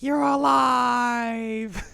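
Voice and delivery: very high, drawn-out cartoon cry; mock joyful cry. Shippo sees his friend alive, hammed-up high cry. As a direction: stretch it into a long high squeal.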